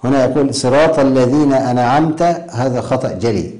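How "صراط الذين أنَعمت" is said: أنعمت said as "ana'amta"; vowelled nūn. Shown as a demonstration. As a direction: add an a after the n of أنعمت. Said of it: "لو فتحها القارئ".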